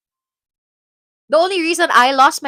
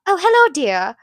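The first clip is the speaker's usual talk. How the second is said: higher-pitched put-on British greeting; mock British accent; 3 semitones higher, 4 dB louder than usual. Mocking the idea of speaking like UK people, British accent. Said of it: "like you're from the U K".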